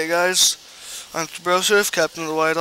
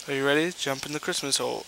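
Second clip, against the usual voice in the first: ordinary talk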